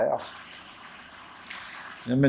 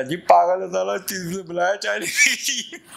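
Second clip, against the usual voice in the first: higher, louder mock grumble with a laugh at the end; grumbling hawker; 6 semitones higher, 4 dB louder than usual. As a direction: imitate the hawker cursing. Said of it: "बड़बड़ा था". (ऊँची आवाज़ में झल्लाता पठान)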